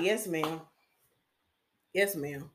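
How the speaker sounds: low, soft, subdued reply; small, obedient voice, dropped low; meek compliance. Retold or imitated. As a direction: give in quietly to an elder. Acting out meek obedience to elder.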